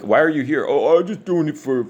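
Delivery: hammed-up innocent question and breezy answer; mocking acted exchange. Asked why he works a job, mock innocent and high.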